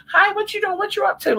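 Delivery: squeaky fake-sweet greeting; high mock sweet caller. Imitating a late-night caller.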